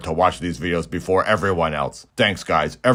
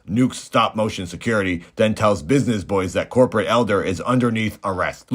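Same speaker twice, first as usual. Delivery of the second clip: ordinary talk, nothing marked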